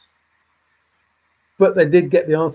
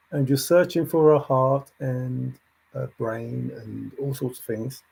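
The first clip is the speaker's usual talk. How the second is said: ordinary talk, nothing marked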